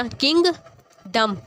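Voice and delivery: "Kingdom" slowly, clear gap after king; split into syllables. Shown as a demonstration. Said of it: "king और एक तरफ हो गया". ("Kingdom" धीरे, किंग और डम अलग)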